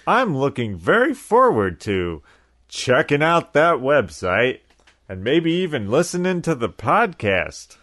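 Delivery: mock-excited, loud and high; hammed-up enthusiasm. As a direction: overplay your eagerness for the plug. Hammed-up promo banter about website.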